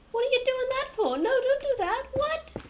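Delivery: high, loud shocked protest; outraged player. Retold or imitated. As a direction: shriek it like an appalled friend. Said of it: "everyone's gonna go".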